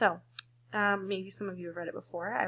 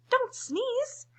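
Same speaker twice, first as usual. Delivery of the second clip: much higher, rising; high squeaky plea; 4 dB louder than usual. Acted. Monkeys' final plea.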